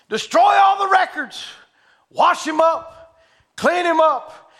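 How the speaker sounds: high, loud royal command; furious royal shout; king's shouted order. Acted. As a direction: shout the decree.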